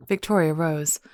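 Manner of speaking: plain reading voice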